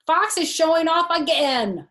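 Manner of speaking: high, loud whiny complaint; tattling kid. Acted. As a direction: tell on him to the teacher.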